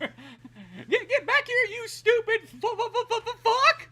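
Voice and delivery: high stuttering cartoon curse; laughing toad voice. Toad chasing someone, high laughing stammer. Acting frog rage, laughing.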